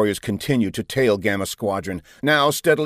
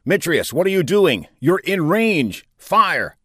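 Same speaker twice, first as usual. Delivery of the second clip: raised, higher commander's voice; urgent command; 8 semitones higher, 3 dB louder, slower than usual. A narrator's reading. Commander ordering his fighter captain to fire, urgent. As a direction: demand action with mounting urgency.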